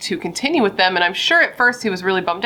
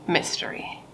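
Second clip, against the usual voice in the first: spooky whisper; 3 semitones lower, 10 dB quieter than usual. Acted. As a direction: whisper the word mystery eerily.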